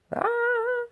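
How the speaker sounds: a high startled yell; Grinch yell. Acted. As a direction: yell as she hits you. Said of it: "yelled the Grinch".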